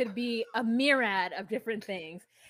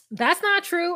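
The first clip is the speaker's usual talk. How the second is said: mock indignant; 8 semitones higher, 7 dB louder than usual. Friend voiced in a retold quarrel.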